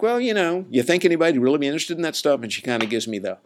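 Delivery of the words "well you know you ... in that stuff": high-pitched, skeptical wondering; quick, high, unconvinced query; retold doubtful question. Retelling his doubts to his media person, skeptical question.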